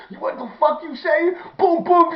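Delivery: high, loud furious yell; angry yell. Retold or imitated. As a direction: yell it mid-fight. Yelling while beating the guy.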